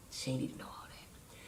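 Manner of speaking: whispered aside. Real, not acted. Confiding to her viewers in a sly whisper. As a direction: let the camera in on a secret.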